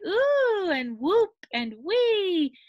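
joyful high party hoots; gleeful animal cries. A narrator's reading. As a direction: squeal each call like playful animals. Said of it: "they all cried".

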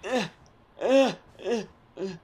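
fake straining. Keeps faking being stuck.

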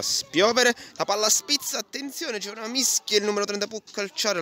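high, rising commentary on a scramble; excited play-by-play. Acted. Excited goalmouth scramble call.

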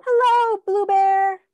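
high, bright greeting to a teddy; singsong hello to a toy bear; cheery hello. Acted. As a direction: greet the little bear sweetly. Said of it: "Say hello to the bear".

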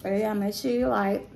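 talking while chewing; eating between words; mouth full. Real, not acted. Seen 0: Forkful eaten at -2.0, hand to mouth until 1.0, chewing shown.

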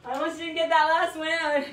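winded, loud and pushed; breathless coach after the final rep; out of breath. Real, not acted. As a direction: gasp it out after the last rep. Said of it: "I'm out of breath".

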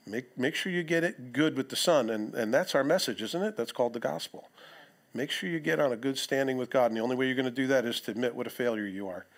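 normal talking voice

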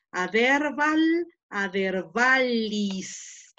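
aderval and adervalis stretched out very slowly; slow careful Latin. Shown as a demonstration. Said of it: "La leo". (aderval, adervalis leído muy despacio)

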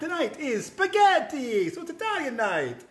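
high, drawn-out, exuberant host greeting; big sing-song welcome, far above normal pitch; put-on show intro. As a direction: open the cooking show in a high, playful put-on voice.

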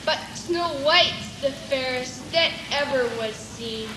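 deep, distant mirror voice; low hollow oracle answering; magic mirror voice. Acted.

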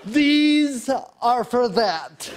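slow insisting. Retold or imitated. Repeats slowly, insisting.

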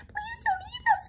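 very high, squeaky pleading; hammed-up frightened squeal; terrified cry. A narrator's reading. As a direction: beg for your life in a tiny high voice. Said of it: "cried the dogs".

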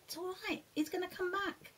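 soft, high, gentle comfort; reassuring. Real, not acted. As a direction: soothe a little one softly. Soothing a child.

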